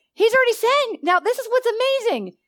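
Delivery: rising excitement. Real, not acted. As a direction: climb toward the big point. Preacher builds to climax.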